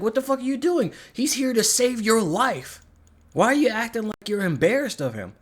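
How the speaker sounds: high-pitched, exasperated telling-off; incredulous scolding. Retold or imitated. Acted scolding of Yelena.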